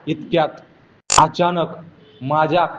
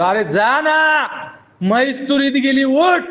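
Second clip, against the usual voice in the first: father shouting; 9 semitones higher than usual. Retold or imitated. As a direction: yell at the lazy son to wake up. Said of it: "बाप म्हणतो". (बापाचा मोठा ओरडा)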